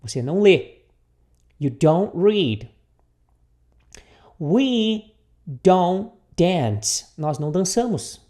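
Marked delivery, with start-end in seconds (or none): 1.6-2.7 slow but joined-up sentence; repeat-after-me model. Shown as a demonstration. Teacher repeats the negative for students, slow and connected. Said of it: "repita comigo aqui". 4.4-7.1 very slow, each word held and separated; repeat-after-me model. Shown as a demonstration. Teacher leads students through the negative, very slowly. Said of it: "repita comigo aqui".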